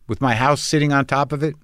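ordinary delivery

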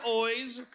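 "oys" as the Australian sound of "eyes"; Australian vowel shown. Shown as a demonstration. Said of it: "your eyes are oys".

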